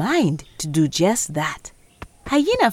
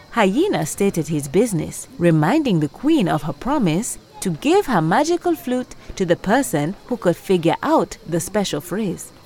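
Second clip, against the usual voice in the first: ordinary delivery, nothing marked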